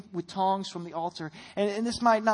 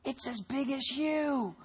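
put-on child's voice; 4 semitones higher, 6 dB quieter, slower than usual. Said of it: "he's like".

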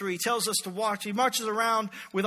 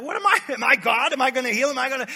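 freaking out, voice jumping up; panicked king; 3 semitones higher, 6 dB louder, faster than usual. Retold or imitated. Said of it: "the king of Israel freaks out".

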